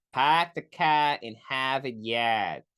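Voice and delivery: slow, drawn-out mock Boston accent; badly faked Massachusetts accent; put-on New England accent. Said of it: "New England accent for some reason".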